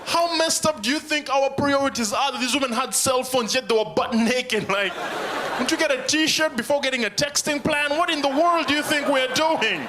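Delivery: shrill up in outrage at the movie; outraged disbelief. Acted. Comic ranting to audience about the movie, high outraged voice.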